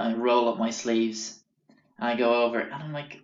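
usual speaking voice

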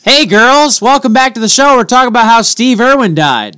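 high, loud, bubbly host voice; hammed-up talk-show welcome to the girls; mock show host. Co-host mocking a show opening, high and loud host voice.

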